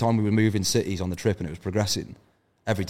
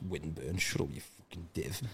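dismissive scoff; 3 semitones lower, 15 dB quieter than usual. Retold or imitated. Mocking his own dismissive reply.

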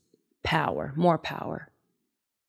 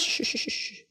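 soft run of quick shushes; repeated soothing shush; 12 dB quieter than usual. Retold or imitated. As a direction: hush a whining husband gently. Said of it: "And she goes".

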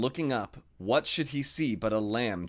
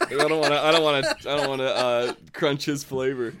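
loud playful protest; 2 semitones higher, 4 dB louder than usual. Acted.

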